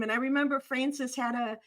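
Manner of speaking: ordinary talk, nothing marked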